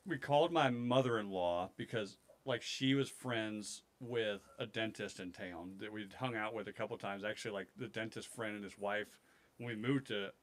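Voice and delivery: ordinary delivery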